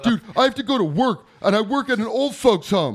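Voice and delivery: high, loud, distressed retold protest; mock freak-out. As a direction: imitate a friend losing it. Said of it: "he's freaking out".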